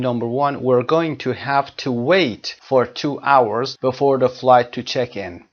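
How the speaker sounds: slow exercise sentence; slow reading. Shown as a demonstration. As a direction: read it slowly for the class. Reads exercise sentence slowly.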